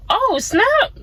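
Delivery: put-on excited kid thanking God for an eraser; mock excited thanks. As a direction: squeal with childlike joy over a small gift. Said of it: "And then you'd be like".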